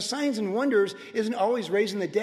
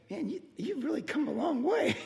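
wife impressed; 8 semitones higher, 3 dB quieter than usual. Retold or imitated. Wife voiced praising him.

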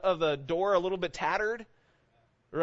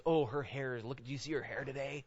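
quieter, catty aside about someone; judgmental gossip; 7 dB quieter than usual. Acted. Prideful churchgoer judging a woman's hair, quiet judgmental gossip. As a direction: gossip under your breath, mildly judgmental. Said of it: "It's our pride that says".

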